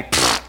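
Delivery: low puff through the lips; dismissive pfft. Acted. Preacher dismissing the excuse that nobody told me, scoffing puff. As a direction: blow off the idea with a scoff.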